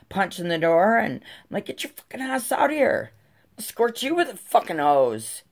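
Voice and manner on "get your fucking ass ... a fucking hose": high-pitched retold hollering; angry threat. Mom punching the neighbor's door, threatening her angrily. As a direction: call her out with a heated threat.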